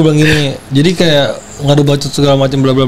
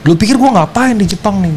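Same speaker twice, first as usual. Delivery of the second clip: higher-pitched heated demand; retold angry challenge; 7 semitones higher than usual. Confronting the ex on the phone, raised heated voice. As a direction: confront him, voice up. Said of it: "Gua bilang".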